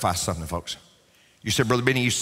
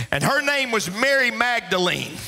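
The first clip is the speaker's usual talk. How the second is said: fervent preaching climax; 7 semitones higher than usual. Real, not acted. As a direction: proclaim it to the whole church, rising to the last word.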